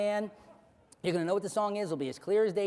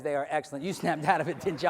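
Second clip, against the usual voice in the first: ordinary talk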